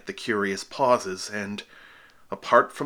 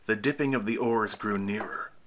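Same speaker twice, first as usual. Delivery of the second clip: plain reading voice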